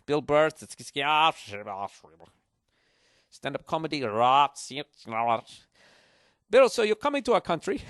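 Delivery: a radio host in Iceland introducing a comedian, loud mock Icelandic accent. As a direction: ham up an Icelandic host's intro, half in his tongue. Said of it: "I can't even do the accent".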